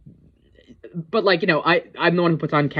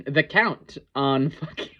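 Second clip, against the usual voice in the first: ordinary talk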